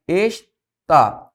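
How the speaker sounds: "está" broken in two, es, pause, tá; syllable by syllable. Shown as a demonstration. Teacher separating "está" for students, syllable by syllable.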